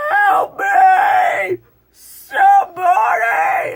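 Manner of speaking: imitated high panicked screams; monster screaming. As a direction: scream for help like a dying crew member. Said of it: "that bear thing is like".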